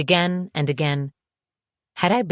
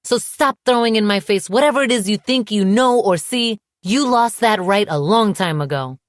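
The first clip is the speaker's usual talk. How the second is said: raised, loud, indignant outburst; unreceding anger; 5 semitones higher, 5 dB louder than usual. A narrator's reading. Woman telling her ex he lost that right, angry. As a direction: tell him off, high and heated. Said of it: "my anger not receding".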